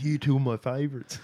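beaming little camper gushing, with a laugh; kid voice. Retold or imitated. Camp kid tells counsellors they're favourites, high and laughing. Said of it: "He goes".

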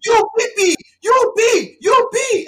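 hammed-up guard pointing it out; mock security guard. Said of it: "And he's like".

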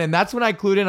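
usual speaking voice

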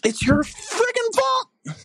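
high, slow, furious cry of blame; angry yell. Acted. Fan yelling at Evan Bouchard, slow, high and furious. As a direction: howl it out at the player, slow and high. Voice-memo caller yelling at player.

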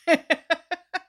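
high rising laugh; laughter. Real, not acted. Still amused by the driver question, laughing. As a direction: let the laugh spill out.

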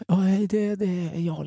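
imitating the ex's timid opening; hesitant quiet voice. Ex calling late at night, timid and soft.

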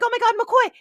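high, loud frantic exclamation; hammed-up shocked cry; acted freak-out. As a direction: call out high in mock panic. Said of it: "He's, like, freaking out".

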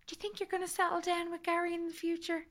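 high, soft imitated mom voice; pry delicately in a high, soft voice; probing mother.

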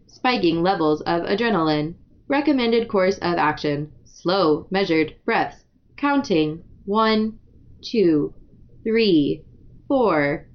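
low, measured android readout. Shown as a demonstration. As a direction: read it like a machine announcing an alert. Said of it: "an android voice".

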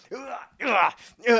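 repeated high "hua" fight noises; mock tussle grunting; imitated scuffle grunts. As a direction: mimic a struggle heard off screen. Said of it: "you just hear all this".

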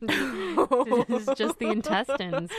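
talking through real laughter; laughing. Real, not acted. Explaining sausage casings to co-host, laughing.

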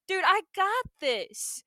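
high, drawn-out swagger; slow, lifted imitation of confidence; mock cocky reassurance. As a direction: stretch it out like a show-off. Voicing cocky Terrence reassuring others.

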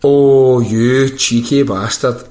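loud, drawn-out indignant exclamation; mock outrage. Real, not acted. Scolding Siri for refusing to translate, loud mock outrage. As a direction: scold it slowly, starting with a loud "oh".

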